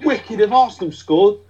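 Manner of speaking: bright quick question from a stranger; passerby voice. Retold or imitated. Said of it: "were like".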